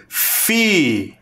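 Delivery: Fi said alone and clearly; syllable sounded out. Shown as a demonstration. Teacher showing pupils the sound of a syllable. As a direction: isolate the syllable Fi. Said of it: "não parece o som do F e do I".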